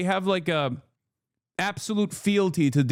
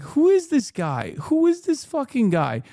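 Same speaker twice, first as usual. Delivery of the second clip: higher, slow and drawn out, baffled; incredulous outburst; 9 semitones higher, slower than usual. Retold or imitated. To chat, baffled by a stranger's post, drawn-out disbelief. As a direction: stretch each word in disbelief. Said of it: "I'm like".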